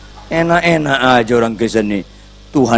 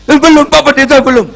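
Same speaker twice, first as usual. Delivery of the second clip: frightened plea; 9 dB louder than usual. Retold or imitated. Husband terrified of dying.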